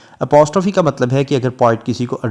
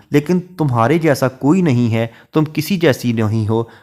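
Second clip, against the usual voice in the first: ordinary talk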